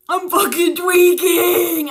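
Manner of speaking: spooked, stretching every word; freaked out. Retold or imitated. Seeing the woman in the back seat, a panicked wail. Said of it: "And I'm like".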